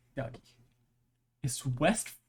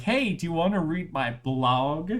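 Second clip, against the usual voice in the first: chirpy spammer; 3 semitones higher, 5 dB louder than usual. Retold or imitated. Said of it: "is like".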